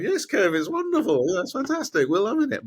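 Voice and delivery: high, strained humoring of the driver; mock enthusiasm. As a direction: play passengers agreeing far too eagerly. Terrified passengers humoring the driver.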